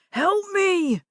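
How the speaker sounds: high, loud cry for help; terrified shout. Acted. Old man crying out to the neighbor for help. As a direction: beg for rescue, panicked. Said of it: "Red's shout sounded terrified".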